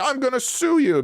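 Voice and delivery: shrill high mock outburst of an irate employee; hysterical employee. Irate fired worker threatening the lawyer, high mock hysteria. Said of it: "irate, hysterical employee saying".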